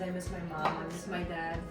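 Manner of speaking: normal talking voice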